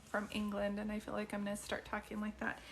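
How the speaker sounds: ordinary talk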